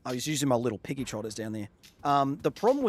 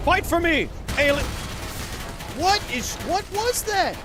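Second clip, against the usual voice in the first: high-pitched, startled combat shrieks; alarmed yelling; 3 dB louder than usual. Real, not acted. Gamer baffled by attacks mid-fight, alarmed yelling. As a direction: cry out way up high in bewilderment.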